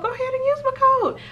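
mock pleading. Creator teasing viewers to use her code, high sing-song. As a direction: tease them into using your code.

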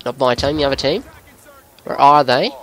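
put-on Aussie gamer commentary; Australian accent. Gamer talking viewers through a match, Australian accent. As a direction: narrate the game in an Australian accent.

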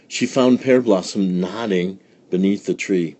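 ordinary delivery, nothing marked

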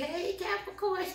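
high, soft, fake-sweet "Hey, Capricorn"; imitating a two-faced friend's sugary hello; fake friendly greeting. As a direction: greet them sweetly but falsely. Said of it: "pretending, you know, being fake".

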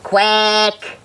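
long "Quack" with an E vowel, like kweck; "Quack" on one level note, rhyming with deck. A narrator's reading. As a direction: duck trying "Quack" as a held kweck. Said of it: "took the E from the hen".

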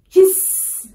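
teacher's simple snake imitation; snake sound. Shown as a demonstration. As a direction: hiss with long trailing s. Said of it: "the sounds of animals".

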